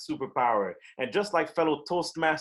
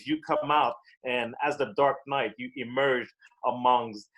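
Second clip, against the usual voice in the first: normal talking voice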